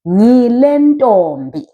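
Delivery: "Ngile ntombi" slow and drawn out; slow teacher's demo of "Ngile ntombi"; model pronunciation. Shown as a demonstration. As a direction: stretch "Ngile ntombi" for learners. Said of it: "you want to say".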